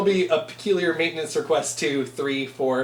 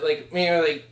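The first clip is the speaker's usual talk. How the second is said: ordinary delivery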